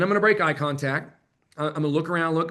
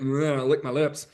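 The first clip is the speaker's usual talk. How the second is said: playful. Shown as a demonstration. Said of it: "I definitely wouldn't overstate it".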